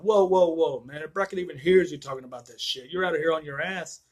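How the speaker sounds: alarmed objection. Acted. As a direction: cut him off, loudly scolding as his partner. Acting out partner's alarmed objection.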